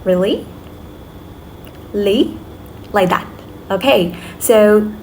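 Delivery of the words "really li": "Really? Li?" swooping up at the end; questioning rise. Shown as a demonstration. Teacher likening rising tone to a question, voice going up. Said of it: "when you're making a question".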